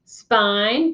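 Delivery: usual speaking voice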